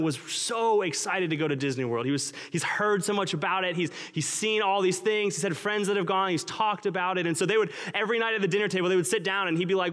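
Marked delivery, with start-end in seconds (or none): none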